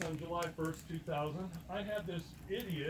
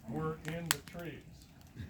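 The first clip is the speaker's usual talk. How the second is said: slow, measured, subdued delivery; mind speak; 6 dB quieter, much slower than usual. Retold or imitated. Zor answering in mind speak, slow and even. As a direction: give it as a slow telepathic message. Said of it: "in the mind speak".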